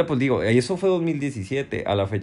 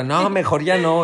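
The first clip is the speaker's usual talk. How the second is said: high, loud, slow whining quote; mock whine of backing out; whiny wife voice; 7 semitones higher, 6 dB louder than usual. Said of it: "Y esta morra".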